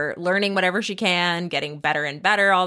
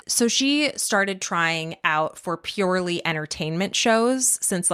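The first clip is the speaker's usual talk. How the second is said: normal talking voice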